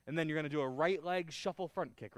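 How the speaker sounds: ordinary talk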